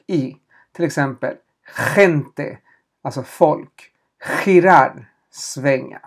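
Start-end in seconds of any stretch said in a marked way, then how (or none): 2.0-2.6 gente said as "khente"; throaty soft G. Shown as a demonstration. Teacher gives an example word, gente with throaty kh. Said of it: "när uttalas bokstaven G som H". 4.2-5.1 girar said as "khirar"; throaty soft G. Shown as a demonstration. Teacher gives a second example, girar with throaty kh. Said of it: "när uttalas bokstaven G som H".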